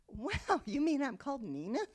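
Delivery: high, loud, laughing imitation of astonishment; amazed mock surprise. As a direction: act out a delighted, astonished discovery. Voices mother's astonishment at birth certificate.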